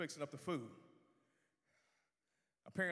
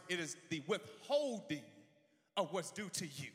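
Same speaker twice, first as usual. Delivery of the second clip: ordinary delivery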